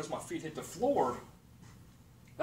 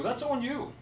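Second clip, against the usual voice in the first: usual speaking voice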